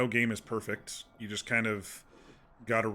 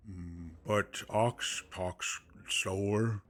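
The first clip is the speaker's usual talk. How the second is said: a slow, lightly voiced half-orc drawl; much slower than usual. Shown as a demonstration. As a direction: speak slowly through the chin like a dim brute. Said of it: "that's Ox's inflection".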